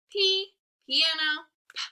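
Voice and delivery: teacher's chant of "P, piano, p" in separate beats; letter, word, sound. Shown as a demonstration. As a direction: drill "P, piano, p" with a voiceless pop last. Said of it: "let's do our letter word sound".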